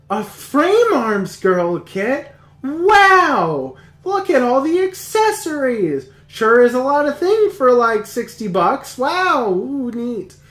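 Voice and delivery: hammed-up delight over a toy kit; gushing anime girl. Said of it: "the show starts out and it's like".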